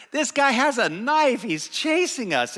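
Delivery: put-on high, loud boyish complaint; worked-up report, pitched well up; alarmed tattling. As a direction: tell on him to Dad in alarm. Boy reporting danger to his dad.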